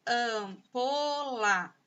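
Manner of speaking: "Ampola" very slow, stretched syllable after syllable. Shown as a demonstration. Teacher showing three sounds to pupils, "Ampola" syllable by syllable. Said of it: "Ampola tem três sons".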